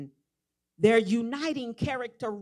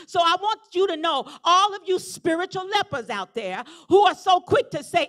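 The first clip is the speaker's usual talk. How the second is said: loud, high, rising preacher's cry; preaching at full force; 7 semitones higher, 9 dB louder than usual. Acted. Preacher urging spiritual lepers to cry out, shouting. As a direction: build the call over the music until it shouts.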